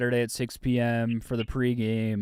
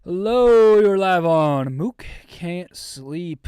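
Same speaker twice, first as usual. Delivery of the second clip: big loud slow announcer greeting; host welcomes the caller on air; radio announcer; 5 semitones higher, 6 dB louder, much slower than usual. Acted. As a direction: open the call like a hyped radio host. Radio host answers a call.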